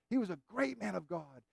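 quiet echo of the praise; soft restatement. Acted. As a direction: let it drop back quietly.

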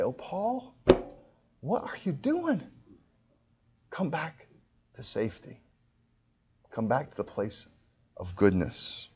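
soft, high plea easing down; loving sorrow. Acted. Jesus looks at the preacher, soft sorrowful pleading. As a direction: plead gently, hushed and sorrowful. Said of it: "and I feel that way".

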